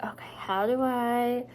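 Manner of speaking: slow worried whisper; slow hushed whisper of worry. Retold or imitated. Panicking quietly.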